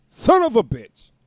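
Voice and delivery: loud, high outburst; exasperated curse. Real, not acted. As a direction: swear loudly at the noise outside. Curses at bulldozers nearing his windows.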